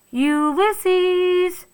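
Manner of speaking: high, loud call into the dark; shouting a name. A narrator's reading. Tootie calling for Ulysses in the dark, loud high shout. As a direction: call out for the lost squirrel. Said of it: "shouted Flora".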